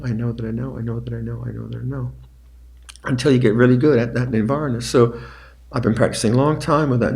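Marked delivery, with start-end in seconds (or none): 0.0-2.1 quiet mental noting. Shown as a demonstration. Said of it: "you keep going back to the knowing".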